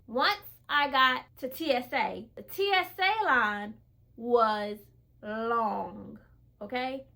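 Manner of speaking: ordinary delivery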